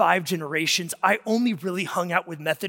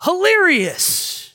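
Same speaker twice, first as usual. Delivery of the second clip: "hilarious" much higher and slow; stress on hilarious; 10 semitones higher, 4 dB louder, much slower than usual. Shown as a demonstration. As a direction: land "hilarious" high and slow, with delight. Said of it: "where we get".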